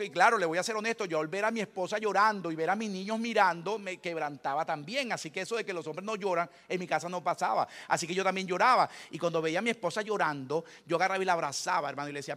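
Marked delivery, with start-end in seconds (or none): none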